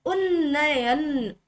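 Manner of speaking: उन्नयन stretched slowly, un-na-yan; slow sounding-out. Shown as a demonstration. Teacher shows students the word, slow syllable-by-syllable उन्नयन. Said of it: "उन्नयन नहीं".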